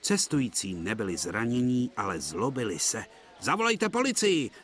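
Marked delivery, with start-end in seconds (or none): 3.4-4.5 angry demand. A narrator's reading. Angry passengers demand police.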